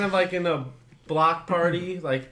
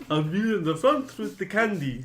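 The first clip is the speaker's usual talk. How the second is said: higher-pitched monster character voice; 5 semitones higher than usual. Acted. Player offers to lead with candy, higher character voice. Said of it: "you guys in character".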